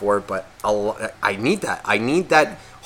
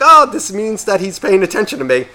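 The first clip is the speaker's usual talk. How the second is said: higher, louder excited dog; delighted dog; 7 semitones higher, 5 dB louder than usual. Acted. Dog gets owner's attention, high and delighted. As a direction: play a dog thrilled to be noticed. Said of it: "you'll be like".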